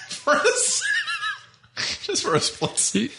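words breaking up into high laughter; too amused to finish the phrase; laughing concession. Real, not acted. Laughing while conceding she was hot.

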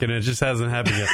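usual speaking voice

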